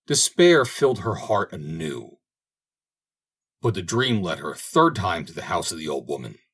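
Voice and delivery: plain reading voice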